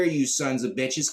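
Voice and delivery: usual speaking voice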